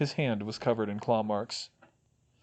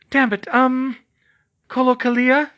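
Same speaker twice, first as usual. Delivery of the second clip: frustrated; 11 semitones higher, 8 dB louder than usual. A narrator's reading. Frustrated then tentative spell.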